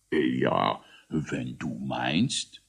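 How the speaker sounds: low, slow, drawn-out agreement; hesitant assent. A narrator's reading. Großmogler yields to a confidential plan, hesitant. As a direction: give in reluctantly.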